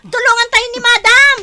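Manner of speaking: loud, high cry for help; panicked shout. Acted. Maid urging help for her collapsed employer, high panicked shout. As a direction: shout so everyone comes running.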